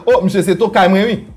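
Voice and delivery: usual speaking voice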